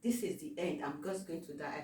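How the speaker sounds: soft, resigned murmur; resigned hush. Retold or imitated. Plane shaking, he quietly resigns himself to die. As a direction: give up, nearly under the breath. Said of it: "So I said".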